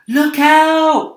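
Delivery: high, loud warning shout; alarmed cry. Acted. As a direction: yell a sudden warning to the others. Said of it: "cried James".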